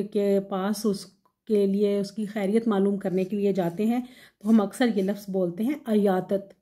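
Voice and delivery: ordinary talk, nothing marked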